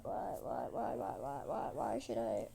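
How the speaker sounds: very low, rapid-fire repeated whys; scoffing repetition. Retold or imitated. Queen refusing to pay the man, scoffing. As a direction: drop the voice deep and chant it.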